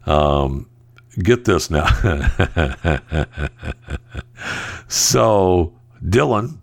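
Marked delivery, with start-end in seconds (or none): 1.9-4.8 a long chuckle, lower than his talk. Real, not acted. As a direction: chuckle through it, amused. Laughs at the news.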